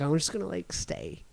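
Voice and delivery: drowsy, barely audible, fading into sleep; a mild imitation of mumbling half awake; sleepy mumble. Half asleep, trying to stay asleep.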